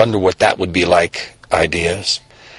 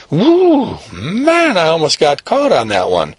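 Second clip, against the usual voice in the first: high breathless whoo of relief; relieved whoop; 7 semitones higher, slower than usual. Acted. Kid to himself after escaping, relieved high whoop. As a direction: whoop it out, glad to have escaped.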